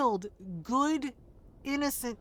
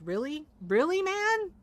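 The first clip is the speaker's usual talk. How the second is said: incredulous outburst; 6 semitones higher, 4 dB louder than usual. Retold or imitated. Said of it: "I'm like".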